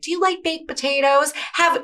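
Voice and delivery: high, coaxing offer of food; mock-gentle coaxing to eat. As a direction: sweetly push her to eat. Sister coaxing mom to eat.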